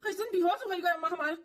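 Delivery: high, alarmed calls to a fainted mother; panicked calling. Acted. Son calling his unconscious mother, alarmed and high. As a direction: call out to her in panic.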